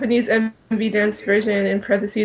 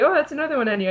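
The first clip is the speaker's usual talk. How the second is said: ordinary talk, nothing marked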